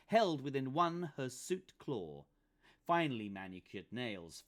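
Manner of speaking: ordinary delivery, nothing marked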